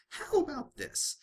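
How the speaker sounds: very high, slow singsong offer; sly Pinkie Pie. A narrator's reading. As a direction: dangle the deal playfully.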